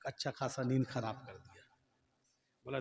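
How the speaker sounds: ordinary delivery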